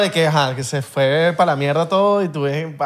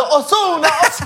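mock calling; 12 semitones higher than usual. Friend imitating soldiers calling out for Ozuna. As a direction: call the name across the beach. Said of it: "O gritando".

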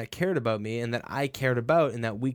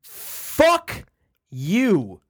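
loud angry cursing; swearing tirade; 5 semitones higher, 6 dB louder than usual. Retold or imitated. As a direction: bark it out furious. Angry outburst at relatives.

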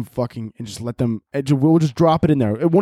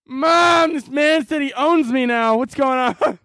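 put-on high, yelling little kid calling for his mom; panicked kid; 11 semitones higher, much slower than usual. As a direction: cry for mom like a scared child. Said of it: "And Jimmy's like".

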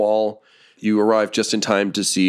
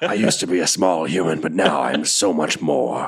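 boastful barrel man. Acted. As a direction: proclaim it as a giant brute, slowing down. Game master voices barrel man.

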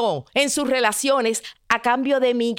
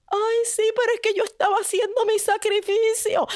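whiny maiden; 11 semitones higher, faster than usual. Acted. Maiden excuses herself to prince.

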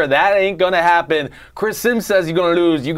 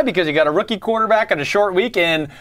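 normal talking voice

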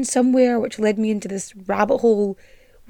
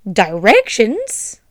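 ordinary talk

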